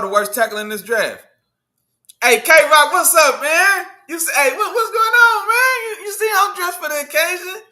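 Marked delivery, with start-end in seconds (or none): 2.2-7.6 high, loud, buzzing hello; pitched way up with excitement; hyped greeting. Real, not acted. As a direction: greet your buddy all fired up. Hyped greeting to a fellow creator.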